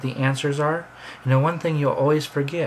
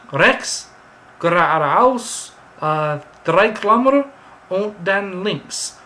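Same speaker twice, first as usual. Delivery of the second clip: rapid native German, higher and louder with hesitations; local giving directions; 4 semitones higher, 6 dB louder, slower than usual. Pretend. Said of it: "And he says".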